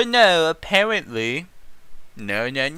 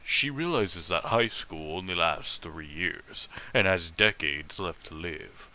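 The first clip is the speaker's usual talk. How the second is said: soft, low reading of the fic; hushed fanfic narration; story narration; 2 semitones lower, 4 dB quieter than usual. Acted. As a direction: read it quietly like a storyteller. Reads fanfic narration aloud.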